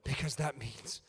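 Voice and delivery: hushed, intimate whisper. Real, not acted. Preacher confiding to congregation, soft whisper. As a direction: whisper it close and tender.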